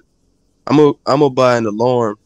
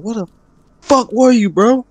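high and louder, incredulous yell; mock outraged friend; 12 semitones higher, 3 dB louder than usual. Imitating his buddy demanding where he was, high mock outrage. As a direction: throw it at him like he vanished on you. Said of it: "And you like".

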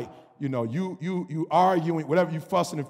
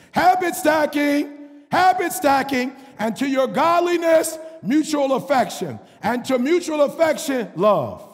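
louder, higher preacher shout; full-force preaching; 7 semitones higher, 4 dB louder, slower than usual. Acted. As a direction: call out the church on their excuses.

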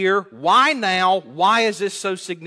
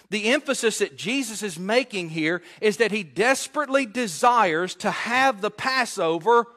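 normal talking voice

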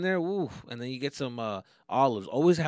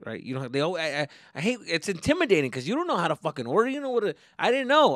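ordinary talk, nothing marked

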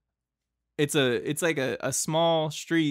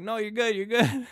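higher-pitched, mildly insistent imitation; insistent refusal; 7 semitones higher than usual. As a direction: wave off the offer, voice lifted. Attendant refusing more money, reassuring.

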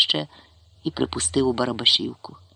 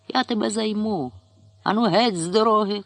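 higher, threatening shout; drunk chairman shouting; 7 semitones higher than usual. A narrator's reading. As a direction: drive the old man off the road. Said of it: "крикнув Сологор".

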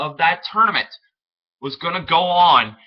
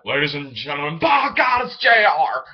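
booming ring announcer, pitch jumping up on "by God"; J R announcer call. Pretend. Pretending to hold a mic for the crowd, booming commentator shout. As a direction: belt it out like a wrestling commentator. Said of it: "I look like I have a mic".

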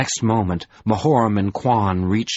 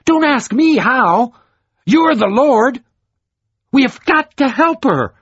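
much higher, louder furious demand; fuming shout; 7 dB louder than usual. A narrator's reading. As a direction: yell at the Lord in fury. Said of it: "Troy fumed".

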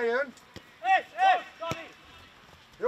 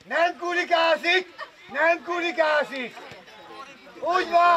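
shouted coaching. Real, not acted. Coach instructs a player, shouting. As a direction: send it across the pitch.

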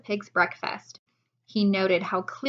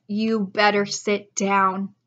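slow grave; 4 dB louder, slower than usual. Acted. Grave warning to wife.

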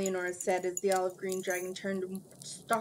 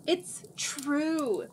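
desperate; 8 semitones higher than usual. A narrator's reading. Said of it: "Cimmerian said desperately".

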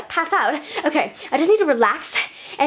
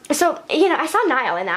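ordinary talk

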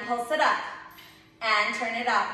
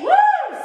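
high shouted woo; loud excited whoop; 10 dB louder than usual. Real, not acted. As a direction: whoop to hype the class. Cheering class in final set.

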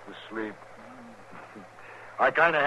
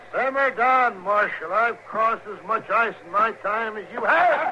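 raised, much higher voice, cocky and dismissive; defiant shout; 8 semitones higher, 4 dB louder than usual. Acted. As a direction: yell back that you know better. Prisoner shouts back over the storm.

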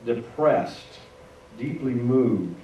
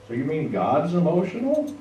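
higher-pitched, rising disbelief; incredulous question; 6 semitones higher, 4 dB louder than usual. Acted. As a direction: ask it as a doubting listener, pitch climbing to the end. Voices a surprised listener's question.

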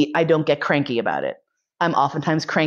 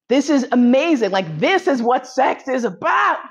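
higher-pitched, building excitement; exhilarated declaration; 6 semitones higher than usual. Retold or imitated. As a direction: proclaim it like a joyful revelation, stretching the end. Same realisation repeated, building excitement.

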